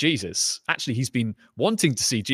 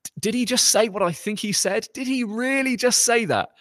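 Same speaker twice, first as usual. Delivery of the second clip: high, incredulous gasping question; a stunned onlooker who can't believe his ears; shocked crowd; 10 semitones higher than usual. Acted. Stunned council member asking about what Jesus said, high and incredulous. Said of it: "You get this sense of excitement".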